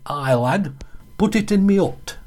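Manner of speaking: high broad Yorkshire voice; Yorkshire steward. Retold or imitated. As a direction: do the Barnsley steward in thick Yorkshire. Barnsley steward in Yorkshire dialect.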